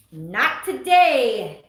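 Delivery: slow, loud, drawn-out defiance; defiant not today. Real, not acted. Reader telling the unwanted card off, slow and loud. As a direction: tell the card firmly, no.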